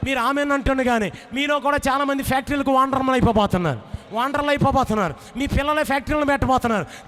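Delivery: loud, driving sermon build to a roar; preaching at full force. Acted. Preacher whipping up the congregation about the widow's two factories, full-force preaching. Rousing sermon climax, calls applause.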